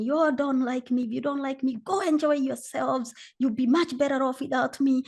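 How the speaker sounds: acted-out triggered outburst; angry accusations. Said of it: "angry and triggered, and I'm making accusations".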